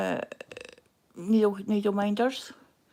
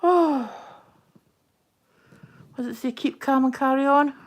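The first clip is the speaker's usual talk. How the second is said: excited; 4 semitones higher, 8 dB louder than usual. Real, not acted. Excited reading a new item.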